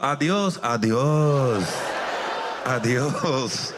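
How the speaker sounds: low Spanish farewell in a put-on Japanese accent; imitation Japanese accent, giving up; mock Japanese accent. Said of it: "speaking Spanish in a Japanese accent".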